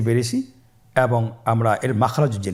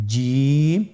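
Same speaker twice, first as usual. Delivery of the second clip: ordinary talk, nothing marked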